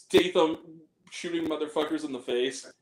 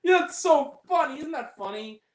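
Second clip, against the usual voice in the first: sarcastic; 8 semitones higher than usual. Acted. Mocking a racist gag.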